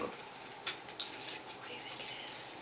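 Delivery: slow, very soft whisper; conspiratorial whisper. A narrator's reading. Storyteller asks children about the mystery, whispered. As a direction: whisper the question and let it hang.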